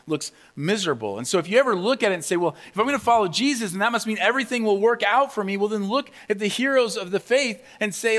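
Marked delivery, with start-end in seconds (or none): 2.4-5.6 high, loud, rushed naive reasoning; naive confidence. Acted. Would-be follower expecting an easy life, naive confidence. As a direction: rattle off a believer's easy assumption. Voicing a naive believer's assumption.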